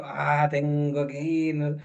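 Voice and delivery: low, slow, grudging "Ah, tengo que ir"; reluctant sigh. Retold or imitated. Husband dragging himself off the couch, slow and grudging. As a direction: drag it out like you'd rather not move.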